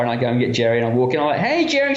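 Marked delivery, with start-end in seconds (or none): 1.4-1.9 high-pitched, fake-casual hello; acted high, breezy hail; put-on cheery greeting. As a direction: greet them like a pretend boyfriend. Acting a staged greeting in bar.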